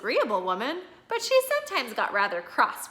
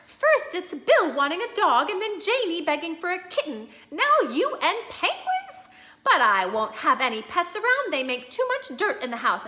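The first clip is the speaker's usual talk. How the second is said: high, louder exasperated wife voice; wife refusing pets; 7 semitones higher, 4 dB louder than usual. Acted. Wife refusing husband's penguin idea, high and exasperated. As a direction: put your foot down, pitched up.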